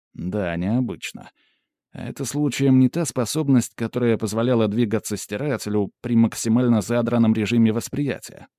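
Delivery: plain reading voice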